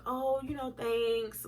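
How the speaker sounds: soft, half-hearted thank-you; subdued thanks. Retold or imitated. Girlfriend hiding disappointment from boyfriend, soft subdued thanks. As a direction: play it down, quietly polite. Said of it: "I'm not even mad".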